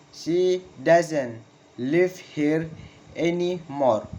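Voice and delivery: very slow, split into pieces, "anymore" falling; slow falling intonation. Shown as a demonstration. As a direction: dictate slowly with pauses between words. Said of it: "Falling intonation in statement".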